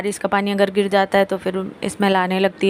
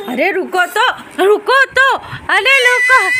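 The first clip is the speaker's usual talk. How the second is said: playful calling out; 12 semitones higher, 5 dB louder, slower than usual. Real, not acted. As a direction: keep calling her to hold still, pitched way up. Calling baby to stop wriggling.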